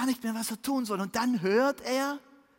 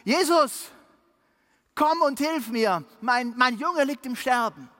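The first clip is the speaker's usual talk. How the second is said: loud, high pleading cry for help; desperate plea; 4 semitones higher, 6 dB louder than usual. A narrator's reading. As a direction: beg loudly, voice straining upward. Father interrupts Jesus, begging.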